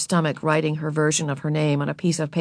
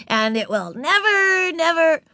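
put-on high, drawn-out little-girl boast; gloat, stretching each never; pleased with herself; 12 semitones higher, 3 dB louder than usual. A narrator's reading. Ramona bragging until interrupted, high and self-satisfied. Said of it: "pleased with herself".

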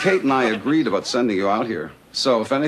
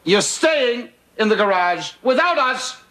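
indignant ruling; 11 semitones higher than usual. Acted. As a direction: lay down the punishment, phrase by phrase. Lays down the sentence.

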